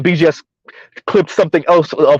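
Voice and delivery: ordinary talk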